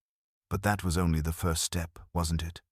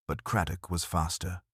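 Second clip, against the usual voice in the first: ordinary delivery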